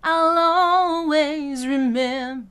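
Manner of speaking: slow and loud, humming the M in "remem-"; leaning on M. Shown as a demonstration. Coach showing consonant anchors. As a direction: lean on the M's. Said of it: "use the M's of remember".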